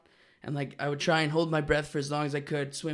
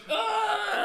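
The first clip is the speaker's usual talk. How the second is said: high retold yell of pain; high-pitched imitation wail; mock pain cry. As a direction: cry out as if just hit, hammed-up. Imitating his cry at head slam.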